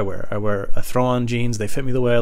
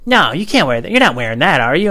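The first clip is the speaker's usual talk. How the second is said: raised, higher voice of a disapproving mother; loud maternal objection; scolding mom; 5 semitones higher, 6 dB louder than usual. Acted. Mom objects in acted quarrel.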